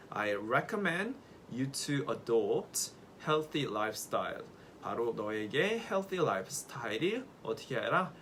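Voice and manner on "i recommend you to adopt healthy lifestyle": very slow, each word separate; slow model sentence. Shown as a demonstration. Teacher reads the recommend example to class, slowly. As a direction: read the sentence slowly for copying. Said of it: "문장으로 가볼게요".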